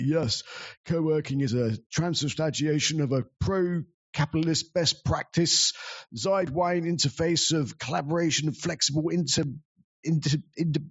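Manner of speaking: mock the pompous host spouting buzzwords; stupid posh voice. Host mocking his own co-working jargon to a crowd, a hammed-up posh drone. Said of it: "I must have sounded so stupid and posh".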